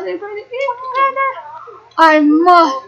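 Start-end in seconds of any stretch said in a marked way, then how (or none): 0.0-1.3 "together" pitched high but softer and fast; hushed excited squeal. Real, not acted. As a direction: squeal it high under your breath. Excited Sims watching.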